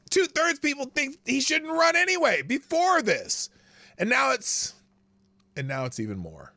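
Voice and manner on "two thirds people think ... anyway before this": loud, high incredulous outburst; esclamazione incredula. Real, not acted. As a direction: exclaim it high and loud in disbelief. Outraged reaction to poll numbers.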